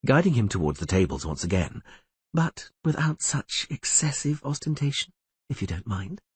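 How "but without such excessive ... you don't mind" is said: a lightly voiced enchantress, murmuring; soft teasing rebuke; murmured. A narrator's reading. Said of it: "she murmured".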